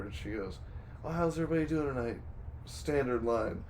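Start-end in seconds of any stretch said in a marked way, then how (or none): 1.0-2.2 quick, higher-pitched waitress patter; imitated server greeting. Server greeting the table, imitated quick and high. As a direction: rattle off a routine server's greeting. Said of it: "the wait server comes over".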